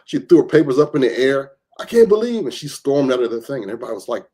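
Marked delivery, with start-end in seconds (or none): 1.8-2.4 outraged mother. Retold or imitated. Mother storms out.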